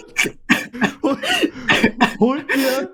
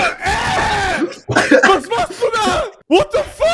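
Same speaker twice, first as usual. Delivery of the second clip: shocked shout; 11 semitones higher, 4 dB louder, much slower than usual. Real, not acted. Shocked outburst at friend.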